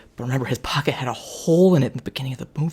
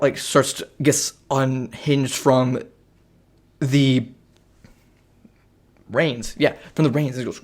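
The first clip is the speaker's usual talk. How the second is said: usual speaking voice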